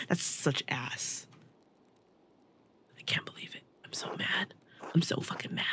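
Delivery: voiceless, fed-up whisper; whispered grumble to yourself; frustrated whisper. Real, not acted. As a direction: hiss it under your breath in frustration. Player muttering frustrated aside.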